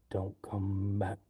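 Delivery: drawn-out hammed-up threat; slow mock warning. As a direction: stretch each word like a movie villain.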